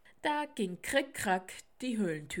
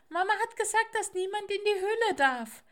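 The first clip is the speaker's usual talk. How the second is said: put-on high, loud, insistent child voice; squeaky little owl; 13 semitones higher, 8 dB louder, faster than usual. Little owl repeats Mama's rule through the door, squeaky and insistent. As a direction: pipe up loudly like an owlet. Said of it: "piepste die kleine Eule".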